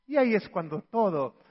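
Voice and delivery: normal talking voice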